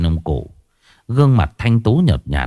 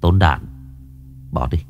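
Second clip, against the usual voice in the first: laconic tough guy; 6 semitones lower than usual. Acted. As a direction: toss it off coolly. Cool young man, offhand.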